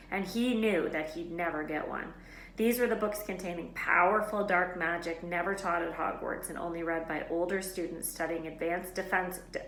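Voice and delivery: plain reading voice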